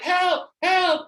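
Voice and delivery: high, loud mock call for help; retold cry for help. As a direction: call out as if stranded, hammed up. Acting out calling for help.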